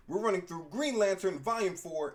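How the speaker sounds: normal talking voice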